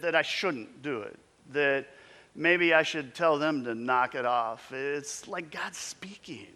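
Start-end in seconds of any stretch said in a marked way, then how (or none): 4.7-6.5 a slow, hushed whisper. Real, not acted. Preacher hushing the church as he names God's voice. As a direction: lean in and whisper it slowly. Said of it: "whispered realization".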